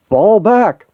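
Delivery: high, loud command to retreat; shouted order. Acted. Cap orders the team back from the robots, shouting. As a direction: bark the order over the chaos.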